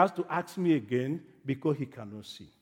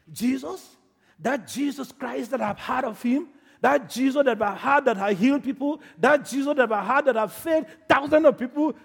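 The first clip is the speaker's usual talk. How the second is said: high, mounting excitement; building from astonished question to loud, high declaration; excited realisation; 8 semitones higher, 6 dB louder than usual. Acted. Blind man learning Jesus is passing, excited realisation.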